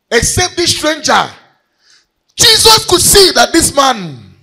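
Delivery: loud, high preaching. Acted. Preacher stresses the stranger to the congregation, loud. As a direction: call it out to the church.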